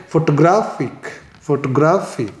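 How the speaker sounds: Photographic as fo-to-GRAF-ik; photographic with the weight on GRAF; stress on third syllable. Shown as a demonstration. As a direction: run lightly up to GRAF in photographic and hit it. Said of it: "graphic पर stress किया".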